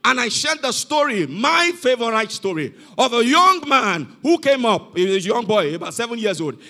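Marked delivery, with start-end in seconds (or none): none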